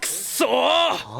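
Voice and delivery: long drawn-out angry yell; drawn-out furious groan of defeat; frustrated curse. Acted. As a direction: howl out your frustration at the cards. Losing at cards, cursing.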